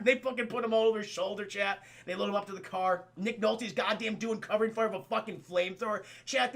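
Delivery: usual speaking voice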